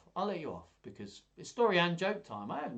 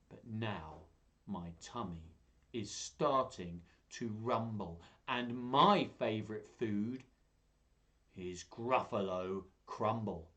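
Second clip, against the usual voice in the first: lower, slow, deliberate threat; menacing mouse; 4 semitones lower than usual. Acted.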